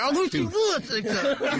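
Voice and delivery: stern mama warning. Retold or imitated. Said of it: "that tone when you know".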